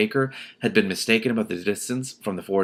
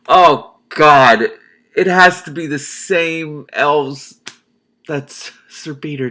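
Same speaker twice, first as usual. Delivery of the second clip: loud, high, slow gasp; excited realization; 6 semitones higher, 8 dB louder, much slower than usual. Real, not acted. Reader realizing the elves link to Sir Peter, loud and slow. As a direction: shout the realization. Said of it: "reader excited realization".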